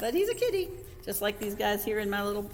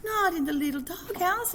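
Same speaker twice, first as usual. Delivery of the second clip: high, loud alarmed outburst; exclaiming in dismay; 13 semitones higher, 5 dB louder than usual. A narrator's reading. Kitten hears the bobolito is in the doghouse, alarmed exclamation. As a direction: cry out high in disbelief. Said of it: "exclaimed Shapi-, Skipito".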